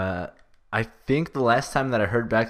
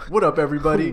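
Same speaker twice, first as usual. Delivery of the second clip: sassy; 4 semitones higher, 4 dB louder, faster than usual. Pretend. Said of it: "that sass".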